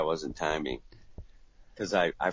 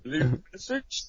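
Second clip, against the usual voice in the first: higher, rising polite offer; polite phone screener; 7 semitones higher than usual. Retold or imitated. Guy faking receptionist voice on the phone.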